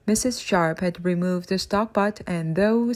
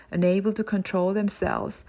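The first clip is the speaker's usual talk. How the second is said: ordinary delivery, nothing marked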